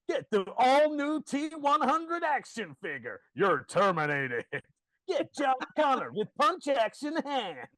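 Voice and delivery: high, loud, slow hype; toy advert announcer. Retold or imitated. Nineties advert selling Terminator toys to children, shouted announcer hype. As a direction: belt it out like a TV toy commercial.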